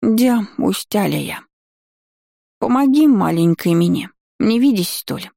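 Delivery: put-on slow babyish lisping whine; drunk childish lisp. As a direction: lisp like a sleepy tipsy toddler. Drunk gargoyle lisps for help. (пьяное детское сюсюканье)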